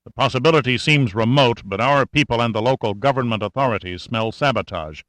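ordinary delivery, nothing marked

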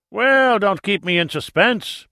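high, loud, drawn-out eagerness; excited. A narrator's reading. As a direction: burst out with impatient excitement. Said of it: "with excitement in his voice".

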